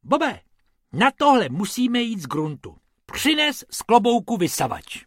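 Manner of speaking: high, loud rabbit orders; bossy rabbit. Acted. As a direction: take charge of the repair.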